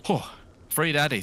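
usual speaking voice

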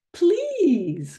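cried please. Acted. The friends begging to play tomorrow, high cry. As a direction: beg eagerly up high. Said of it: "cried all the rest".

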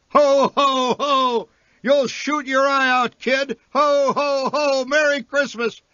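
very high, hearty ho-ho-ho dismissal; jolly Santa. A narrator's reading. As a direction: brush the kid off with a big Santa laugh.

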